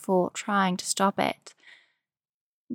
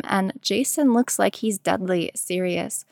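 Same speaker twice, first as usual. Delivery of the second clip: ordinary delivery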